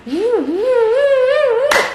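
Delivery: gusting wind call; wind whoosh. Acted. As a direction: let the wind soar up high. Wind blows hard.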